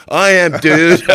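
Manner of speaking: shouting back. Retold or imitated. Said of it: "And I go".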